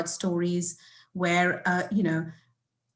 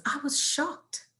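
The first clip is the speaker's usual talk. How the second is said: ordinary talk, nothing marked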